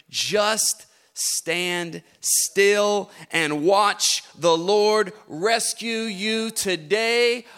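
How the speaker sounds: very slow, each word weighed; slow emphatic. Acted. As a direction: drag it out so it sinks in.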